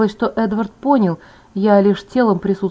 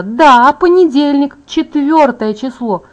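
higher, louder, impatient confirmation; exasperated; 5 semitones higher, 5 dB louder, slower than usual. A narrator's reading. Alice rolls her eyes at her friend's answer, exasperated. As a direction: spell out the obvious impatiently.

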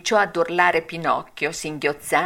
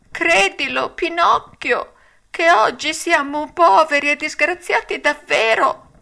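high, louder pleading whine; whining fox voice; 10 semitones higher, 4 dB louder than usual. A narrator's reading. Fox pleading with Pinocchio, whining. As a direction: plead in a sorry whimper. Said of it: "voce di piagnisteo".

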